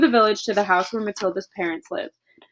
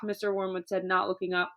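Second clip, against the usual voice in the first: usual speaking voice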